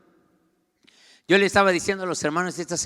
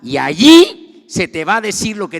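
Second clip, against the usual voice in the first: loud, higher emphatic order; the Lord commanding; 5 semitones higher, 13 dB louder than usual. Acted. Preacher voices the Lord instructing Saulo, loud command. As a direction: proclaim the instruction forcefully. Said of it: "Le dijo".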